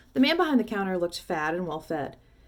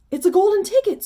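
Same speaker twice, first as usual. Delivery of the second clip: high, loud excited scream; 13 semitones higher, 12 dB louder than usual. A narrator's reading. Shopkeeper to the whole shop, screaming with excitement. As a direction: shriek it out in disbelief. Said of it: "screamed the shopkeeper".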